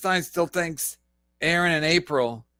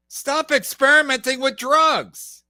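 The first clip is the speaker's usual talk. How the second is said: louder, slower shouted repeat; mock yell; 6 semitones higher, 5 dB louder than usual. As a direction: throw the clip's words back as a loud mockery. Mock repeat of clip words.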